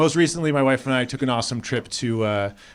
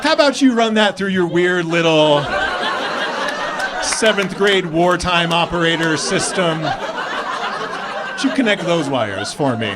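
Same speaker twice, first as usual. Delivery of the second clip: raised, exasperated phone rant; worked-up comic tirade; sarcastic rant; 4 semitones higher, 3 dB louder, slower than usual. Acted. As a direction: lash out in mock outrage at the gossip chain. Acted sarcastic rant at his mom.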